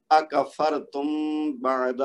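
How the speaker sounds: measured اکفرتم with the meem hummed before بعد; tajweed recitation. Shown as a demonstration. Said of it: "ان کو one by one سب کو پڑھتا ہوں".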